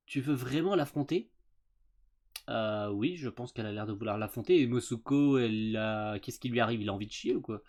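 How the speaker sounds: ordinary talk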